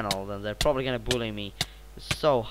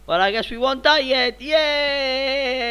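high, loud cheer with a long held final note; celebratory cheer; 11 semitones higher, 8 dB louder, slower than usual. Real, not acted. Gamer celebrating staying alive, high-pitched cheering. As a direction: cheer loudly and stretch out the last word.

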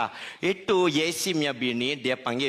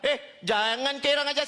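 higher-pitched annoyed scolding; mock angry; 9 semitones higher than usual. The angry man tells him to stop saying the nickname, mock angry. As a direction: scold him, a bit hammed-up. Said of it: "Dia lagi marah".

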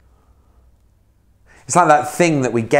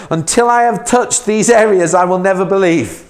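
venomous defiance; 7 semitones higher, 4 dB louder than usual. Acted. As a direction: spit each until out like venom. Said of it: "he spits out some venom".

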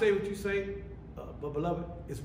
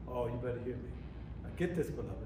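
normal talking voice

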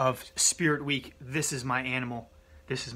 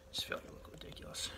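whisper; 14 dB quieter than usual. Real, not acted. Embarrassed aside while filming.

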